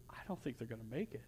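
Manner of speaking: very soft, low, near-whispered sorrow; tearful. Acted. God looking down on us, hushed tearful sorrow. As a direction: say it hushed, as if through tears. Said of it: "with tears in, in his eyes".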